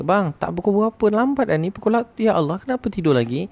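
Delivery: very high, fretful wife voice; acted panic. Wife waking husband who is late for work, mock panic. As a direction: play a panicking wife, pitched right up. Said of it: "Satu keluarga panik".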